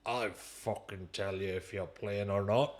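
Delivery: put-on gruff-worded manager in a poor Geordie accent; bad Geordie accent. Manager overruling his player, in bad Geordie. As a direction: lay down the law in badly imitated Geordie. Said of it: "That's the worst Geordie accent".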